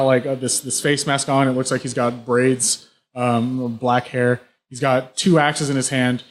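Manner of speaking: normal talking voice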